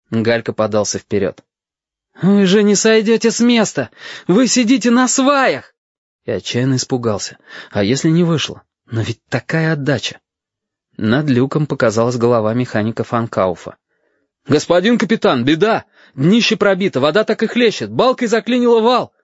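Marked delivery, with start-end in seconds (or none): 2.2-5.7 put-on high, emphatic boyish exclamation; urgent outburst. A narrator's reading. Boy tells officers the ship is stuck, exclaiming. 14.5-19.1 high, agitated alarm; alarmed report. A narrator's reading. Mechanic tells captain the hull is holed, alarmed.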